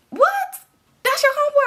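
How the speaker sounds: hammed-up shocked boyfriend. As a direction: shriek it in disbelief. Enemy shocked, voiced very high.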